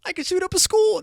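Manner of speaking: mock edgy joke.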